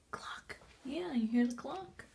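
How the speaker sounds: soft whisper, barely voiced. Real, not acted. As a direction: whisper so you don't wake anyone. Quiet listening to the clock.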